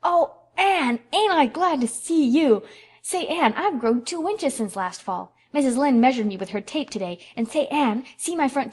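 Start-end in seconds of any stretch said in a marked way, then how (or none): 0.0-2.6 put-on high, loud little-boy shout of joy; joyful yell. A narrator's reading. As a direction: burst in yelling with delight at Anne. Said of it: "with a joyful yell".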